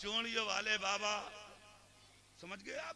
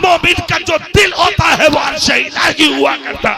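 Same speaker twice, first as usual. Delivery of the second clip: much louder and higher, half-sung and intoned; voice from the bier; 8 semitones higher, 28 dB louder than usual. A narrator's reading. Dead saint's voice calling to the pallbearer, loud intoned chant. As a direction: chant it like a voice from beyond. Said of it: "जनाजे से आवाज आई".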